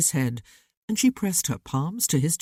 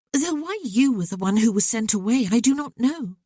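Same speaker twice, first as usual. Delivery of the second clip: put-on higher young woman, earnest and hurt; young sister's voice; 7 semitones higher, 3 dB louder than usual. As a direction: let the sister pour out old grievances to her brother. Sister continues.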